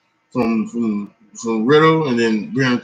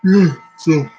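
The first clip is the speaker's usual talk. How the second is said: words stretched by a yawn; 4 semitones higher, 5 dB louder than usual. Real, not acted. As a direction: say it mid-yawn. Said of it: "I apologize for yawning".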